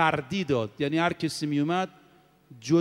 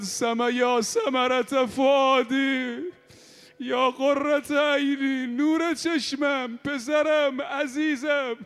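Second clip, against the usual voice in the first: mother's lament; 8 semitones higher, much slower than usual. Acted. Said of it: "صدا زد".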